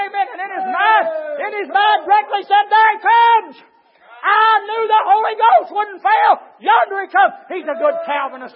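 loud, high, shouted preaching; preaching at full force. Acted. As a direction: cry it out like a revival preacher. Preacher builds to full cry.

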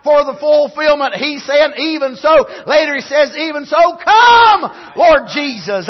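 loud, high preacher's shout; preaching at full force. Acted. Pastor condemning the world's mentality, loud preaching. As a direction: hammer the point home to the church.

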